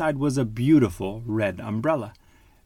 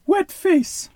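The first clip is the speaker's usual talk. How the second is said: put-on very high, squeaky baby duck pout; a sulky little duckling complaining; 4 dB louder than usual. A narrator's reading. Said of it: "pouted Baby".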